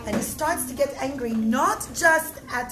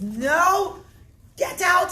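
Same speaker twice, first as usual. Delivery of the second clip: loud, higher angry order to leave; angry shout; 6 semitones higher, 7 dB louder, much slower than usual. Acted. Host ordering Bar Kamsa out, angry shout. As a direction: throw him out with a furious yell. Host throws guest out of party.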